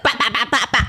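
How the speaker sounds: imitated whacks in quick succession; quick repeated "bah" with a laugh; rapid-fire hammering noise. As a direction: mimic a flurry of hammer blows. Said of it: "like, rapid succession".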